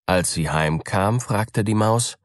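usual speaking voice

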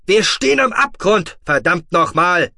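loud, high, exasperated outburst; angry shout. A narrator's reading. Terran warns Otoba they face the abyss, shouting angrily. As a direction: shout the warning and curse with full force.

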